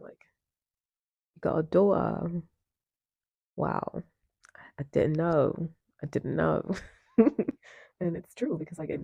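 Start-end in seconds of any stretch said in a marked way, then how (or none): 1.3-6.7 put-on British accent with pauses; mock British, phrase by phrase. Shown as a demonstration. [name] repeats himself to Jace, mock British accent. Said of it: "I gotta say this in a British accent".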